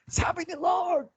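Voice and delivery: high, slow mock preacher cry; mock shouting preacher. Mocking other preachers' style for church workers, hammed-up preacher cry. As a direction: ham up a fiery preacher. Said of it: "gagayahin natin yung mga ibang preacher".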